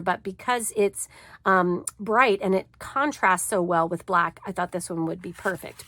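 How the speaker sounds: ordinary delivery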